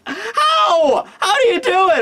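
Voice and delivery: high, drawn-out incredulous cry; baffled outburst. Acted. Reacting to a friend lost on a bus, baffled. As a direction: squeal it in mock disbelief.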